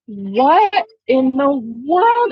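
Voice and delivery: slow, drawn-out amazed question; stunned disbelief. Retold or imitated. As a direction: let the wonder stretch each word. Stunned reaction to sleeping mare.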